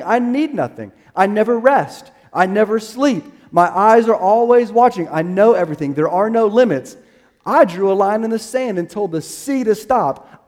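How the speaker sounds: rising sermon proclamation, every line hit hard; preaching at full force. Acted. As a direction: thunder out God's greatness line by line. Declaring God's attributes.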